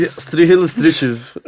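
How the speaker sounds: Strychilov then Strychiv, repeated high and loud; unsure repeat. Real, not acted. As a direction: fumble through Strychilov and Strychiv. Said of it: "some trouble with the street names".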